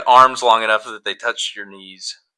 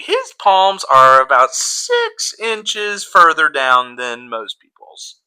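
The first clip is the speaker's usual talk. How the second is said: drawn-out, high remark on an oddity; mock astonished onlooker, stretching the words; gawking onlooker; 5 semitones higher, 4 dB louder, much slower than usual. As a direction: slowly marvel in a raised voice, trailing down. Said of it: "They're like".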